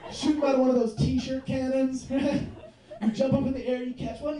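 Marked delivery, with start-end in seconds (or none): none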